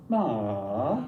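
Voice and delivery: "ma" dropping low, then rising back up; dipping tone. Shown as a demonstration. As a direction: scoop "ma" down and up. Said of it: "a dipping tone".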